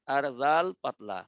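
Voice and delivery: ordinary delivery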